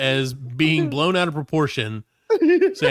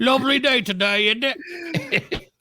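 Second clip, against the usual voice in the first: high, hammed-up Cockney greeting; mock British accent; 8 semitones higher, slower than usual. British nurse greets the patient in mock British accent. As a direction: put on a silly British accent. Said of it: "he's British".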